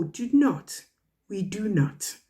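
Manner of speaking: ordinary delivery, nothing marked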